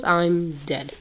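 ordinary talk

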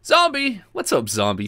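high, bright call to a friend; excited greeting. Real, not acted. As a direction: call out the name with glee. Greeting a viewer by calling out.

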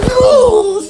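high loud yelp of laughter; startled laughing cry. Real, not acted. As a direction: burst out in a shocked laughing squeal. Reacting to dancer jumping.

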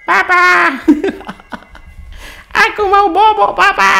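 put-on high, loud whining toddler begging for sleep; high baby voice crying for papa with a giggle; whiny baby voice. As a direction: whine like a sleepy little kid.

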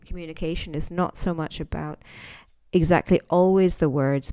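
ordinary delivery

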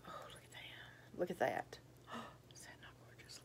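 excited whisper. Real, not acted. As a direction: whisper like sharing a treasure.

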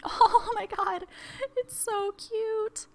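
very high, drawn-out cutesy gush; gushing over cuteness. Real, not acted. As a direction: melt over something adorable, slow and high.

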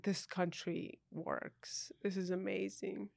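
slow, soft and hushed in wonder; awed hush. Retold or imitated. Recalling her first night drive, in a slow awed hush. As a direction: barely breathe it out in awe. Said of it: "enough for me to be like".

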